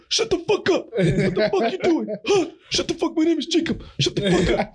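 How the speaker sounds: a high-pitched cartoon tantrum; friends' impression of me. Friends parody how he cusses, gasping high squeaky rant. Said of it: "their impression of me was like".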